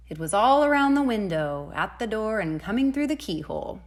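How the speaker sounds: ordinary delivery, nothing marked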